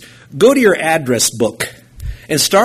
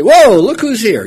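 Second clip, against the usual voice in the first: excited greeting; 8 semitones higher, 7 dB louder than usual. Pretend. Greeting relatives in heaven.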